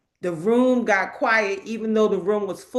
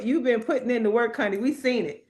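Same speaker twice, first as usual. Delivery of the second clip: ordinary talk, nothing marked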